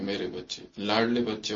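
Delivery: soft, low endearment; loving address. Shown as a demonstration. Baba to his darling children, softly loving. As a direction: speak tenderly, dropped low and quiet. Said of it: "پیار سے بولو ایک دو سے".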